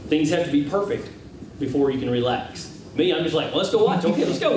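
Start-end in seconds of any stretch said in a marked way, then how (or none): 3.5-4.6 higher, eager hurry-up; playfully acted impatience to go; eager urging. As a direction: urge them along, pitch climbing. Acting his eager self beside wife.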